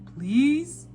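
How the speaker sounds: storybook Santa making a request; Santa Claus. A narrator's reading. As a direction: plead in a put-on Santa voice.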